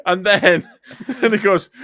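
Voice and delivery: high, loud, laugh-choked telling; words broken by giggles. Real, not acted. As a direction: struggle to get the story out through laughter. Laughing too hard to tell it.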